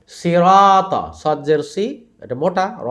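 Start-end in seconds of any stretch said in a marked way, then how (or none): 0.1-1.1 সিরাতা with all sounds thick and রা held long; full, rounded consonants, sustain the middle of সিরাতা; heavy, stretched recitation. Shown as a demonstration. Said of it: "রতে আলিফ মাদ এবং তিনটা অক্ষরই মোটা".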